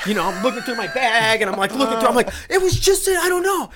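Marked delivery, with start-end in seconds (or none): 2.5-3.7 high, flustered panic over the missing slip; searching the bag in a high panic; panicky. Retold or imitated. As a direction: sound suddenly frantic, voice jumping way up.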